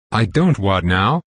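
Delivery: usual speaking voice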